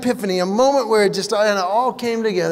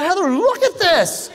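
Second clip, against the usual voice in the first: high, slow pleading call; hammed-up worried husband; mock alarm; 9 semitones higher than usual. Husband showing his wife Heather an ear hair, high and slow.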